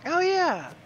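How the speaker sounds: high, drawn-out cartoon falsetto; squeaky mascot agreeing; Mickey Mouse. Player agrees with friend, Mickey Mouse impression. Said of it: "I don't think he talked like Mickey Mouse".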